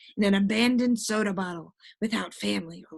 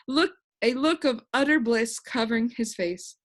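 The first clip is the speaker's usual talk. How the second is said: ordinary delivery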